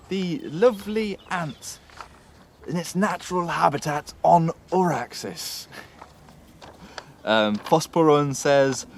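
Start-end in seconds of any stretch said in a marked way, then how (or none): 0.1-5.7 slow, hushed wildlife narrator voice; nature documentary. Pretend. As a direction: introduce the ant like a wildlife film host. Presents ant like wildlife film.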